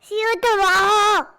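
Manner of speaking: loud shouted farewell; shouting. Real, not acted. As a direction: yell it straight into the mic. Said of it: "Why are you shouting in my ears?".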